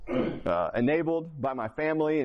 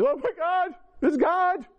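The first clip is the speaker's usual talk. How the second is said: high wailing sob voice, pitched way up; mimicking his wife's tearful wail; mock bawling; 5 dB louder, slower than usual. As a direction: imitate her crying out through tears. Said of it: "She's bawling".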